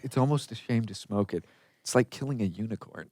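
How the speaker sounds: hushed quote. Retold or imitated. Quoting Pineapple Express to friends, hushed and low. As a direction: drop to a hushed, awed hush. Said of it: "That's the only thing I liked".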